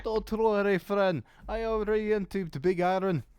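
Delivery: put-on a vague Eastern European merchant accent, lightly done; sly salesman voice with an unplaced European accent; mystery European accent. Shady merchant reassuring a customer, in a put-on European accent. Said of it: "don't ask me what this accent is".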